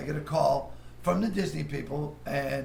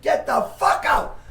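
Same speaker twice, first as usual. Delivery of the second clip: slow, loud, higher yell; retold shout; 10 semitones higher, 7 dB louder, slower than usual. Studio boss finally ejecting him, slow loud yell. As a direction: drag out the final order.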